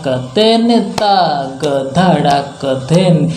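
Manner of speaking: slow tempo. Shown as a demonstration. Teacher reciting bols at slow tempo to students. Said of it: "इसको हम धीमा लय में पहले सीखेंगे".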